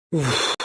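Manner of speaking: soft, breath-out sigh of relief; relieved sigh. A narrator's reading. Jackie sees the performer is safe, relieved sigh. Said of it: "soupire Jackie".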